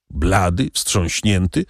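ordinary delivery, nothing marked

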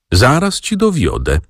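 ordinary delivery

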